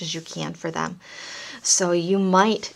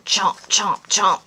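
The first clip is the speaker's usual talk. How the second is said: shark chomp; 6 dB louder, much slower than usual. Shown as a demonstration. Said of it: "animal noises".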